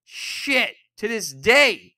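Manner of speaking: ordinary talk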